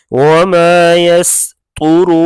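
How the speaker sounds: slow demo of वमा यस्तुरून; heavy ra. Shown as a demonstration. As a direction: sing out यस्तुरून with a thick ra. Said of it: "रा को भी पुर पढ़ेंगे".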